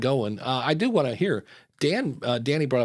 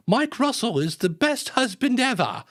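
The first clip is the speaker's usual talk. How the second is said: high-pitched mock wife gushing over her husband; hammed-up falsetto praise from a wife; imitated wife's voice; 10 semitones higher than usual. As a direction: put on a high, adoring wife voice. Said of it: "it's his wife's voice".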